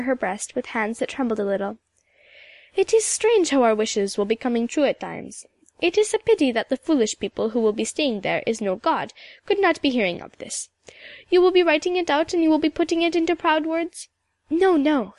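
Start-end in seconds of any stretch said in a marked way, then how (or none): 2.8-14.1 put-on old Scottish storyteller with a lilting accent; old Highland woman. Mistress McIntyre answers Emily. 14.5-15.1 a touch of hasty denial; mildly hurried young objection; quick protest. Acted. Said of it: "said Emily quickly".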